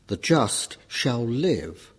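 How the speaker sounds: The just shall live slowed, with live weighted; slow the quote and lean into live; stress on live. Shown as a demonstration. Quoting Habakkuk to the class, live stressed slowly. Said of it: "emphasis on the word live".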